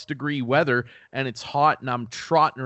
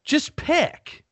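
high, exasperated jab at the weather; pitched-up mock demand; exasperated plea; 13 semitones higher than usual. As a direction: squeak it up high, fed up. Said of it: "and I'm like".

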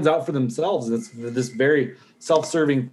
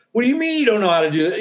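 fast, higher, incredulous and annoyed; exasperated outburst; 7 semitones higher, faster than usual. Retold or imitated. Veteran voicing his impatience with civilians, exasperated. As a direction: snap it out in disbelief. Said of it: "I get frustrated".

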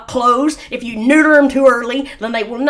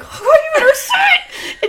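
high, slow, laughing warning; slow high teasing threat; high slow laughing warning to the dog; laughing mock threat; slower than usual.

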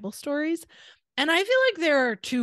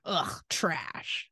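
softer, dismissive grunt of disgust; parents' disdain; 5 semitones lower, 5 dB quieter than usual. Retold or imitated. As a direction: imitate parents scoffing at cheesy videos. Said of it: "they would be like".

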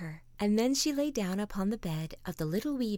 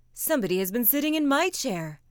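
raised middle-sized bear voice; louder, somewhat higher medium bear; 5 semitones higher, 7 dB louder than usual. A narrator's reading. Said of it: "in his middle-sized voice".

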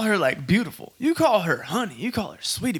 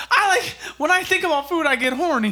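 mocking quote; 11 semitones higher than usual. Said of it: "Nick said".

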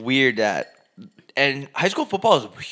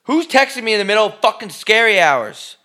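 startled outburst; 10 semitones higher, 6 dB louder than usual. Real, not acted. Startled by a text mid-recording.

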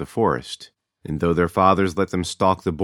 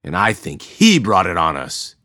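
louder, pointed accusation; accusing; 2 semitones higher, 6 dB louder than usual. A narrator's reading. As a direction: jab the blame at the stranger. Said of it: "he added".

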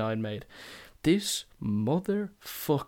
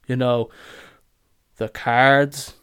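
loud, exasperated you know the cards; impatient mock explaining; 10 dB louder, slower than usual. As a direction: blast it like it's obvious.

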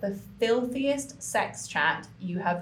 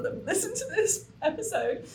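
laughing in mock dread; 10 semitones higher than usual. Real, not acted. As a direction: laugh through the words, voice climbing. Embarrassed laugh at own confession.

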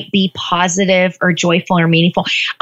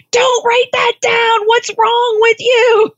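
punisher voice; 11 semitones higher than usual. Retold or imitated. Inner critic scolding herself, high and tearful. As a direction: play the harsh inner punisher, near tears. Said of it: "You might hear a punisher".

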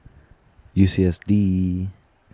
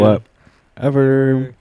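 mock dismissive; 7 semitones higher, 5 dB louder, much slower than usual. Friend mocking the catchphrase.

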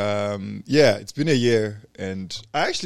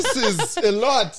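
amused; 9 semitones higher, slower than usual. Real, not acted.

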